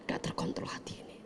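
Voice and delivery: hushed whisper; whispered warning. Real, not acted. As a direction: drop to a whisper. Whispered aside on rage.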